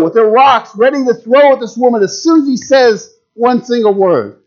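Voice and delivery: ordinary talk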